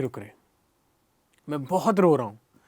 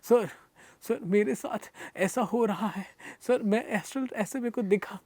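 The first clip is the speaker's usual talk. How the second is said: higher, softer, slow tearful plea to the master; retold tearful pleading; 4 semitones higher, 6 dB quieter than usual. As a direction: replay yourself sobbing out the story to your guru. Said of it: "बता रहा हूँ रो रो के".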